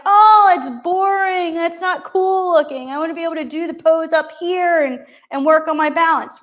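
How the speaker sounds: mock a student who finds the easy pose dull; whiny student. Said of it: "You're like".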